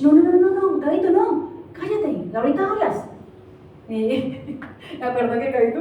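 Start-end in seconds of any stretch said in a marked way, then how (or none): 0.0-3.1 put-on high-pitched demonstrated hushing of a child; madre que manda callar. Shown as a demonstration. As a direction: cut the child off with quick, higher no-no-no. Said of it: "¿Qué sentiría si te dijera". (madre que calla al niño, voz aguda)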